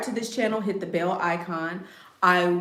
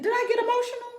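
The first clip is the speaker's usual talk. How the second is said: squeaky high; much faster than usual. Real, not acted. Squeaky self-question.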